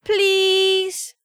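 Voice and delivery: put-on high, drawn-out childish please; long wheedling kid plea; pleading children. A narrator's reading. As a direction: beg like two kids at once. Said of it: "the two children chorused".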